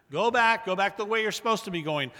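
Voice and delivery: high, loud, urgent call back; loud command. Real, not acted. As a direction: order them to turn around.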